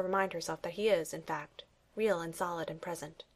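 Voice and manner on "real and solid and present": ordinary delivery, nothing marked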